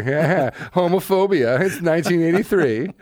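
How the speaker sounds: tossed off in a silly voice; mocking the dated joke lightly; mock retort.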